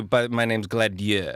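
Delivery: drawn-out mock-French "Gladieux"; sudden French accent. Shown as a demonstration. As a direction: switch into French for "Gladieux", slowly. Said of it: "and then switch to".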